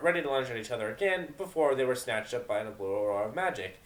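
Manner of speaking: plain reading voice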